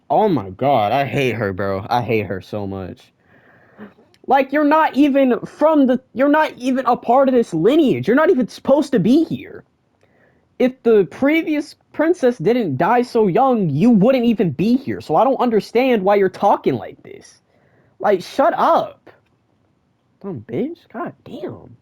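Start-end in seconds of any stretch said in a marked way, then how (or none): 4.3-19.1 much higher and louder, furious telling off; furious rant. Real, not acted. Viewer yelling at the princess's mother, furious. 20.2-21.8 muttered curse. Real, not acted. Muttered insult after the rant.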